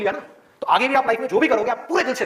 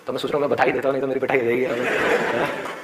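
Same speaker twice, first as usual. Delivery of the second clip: ordinary talk, nothing marked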